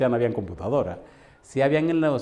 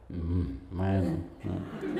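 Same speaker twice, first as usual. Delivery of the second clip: sheepish mumble; 7 semitones lower, 8 dB quieter, much slower than usual. Retold or imitated. Backslider stammers excuses.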